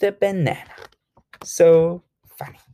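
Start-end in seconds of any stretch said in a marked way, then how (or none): none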